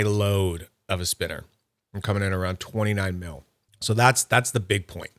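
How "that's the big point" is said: ordinary talk, nothing marked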